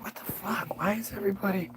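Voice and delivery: whispered disbelief under the breath; whispered 'what the fuck'; whispered aside. Pretend. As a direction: mutter it so nobody hears.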